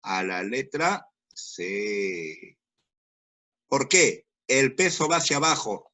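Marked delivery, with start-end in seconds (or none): 0.0-2.6 drawn out slowly and lower, letra stressed; slow answer reveal. Shown as a demonstration. Teacher revealing the correct option to the class, slow and low.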